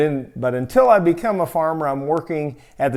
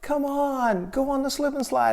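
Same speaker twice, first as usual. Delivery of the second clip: mock childish begging, pitched much higher; pleading kids; 9 semitones higher, 4 dB quieter than usual. Wife and little brother urging tired husband onto the slide, high wheedling voice. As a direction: pester him like an eager little kid.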